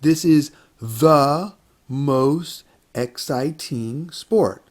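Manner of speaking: drawn out and carefully spaced; slow model sentence. Shown as a demonstration. Teacher modeling a superlative sentence for students, slow and clear. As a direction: say it slowly, word by word. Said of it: "Let's try a superlative".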